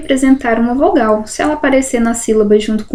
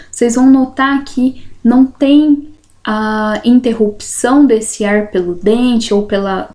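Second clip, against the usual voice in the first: usual speaking voice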